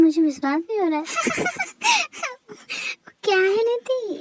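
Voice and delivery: quick words breaking into a high laugh; laughing disbelief. Acted. Amazed at the tasty food, laughing. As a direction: laugh it off in surprise.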